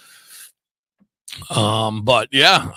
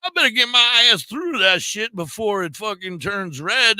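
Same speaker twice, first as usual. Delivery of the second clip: put-on drunk driver; 7 semitones higher than usual. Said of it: "I was trying to go drunk".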